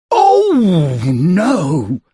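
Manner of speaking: loud high gasped oh no. A narrator's reading. Mister Stingy shocked by tomato ears. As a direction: gasp in shock. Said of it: "he gasped".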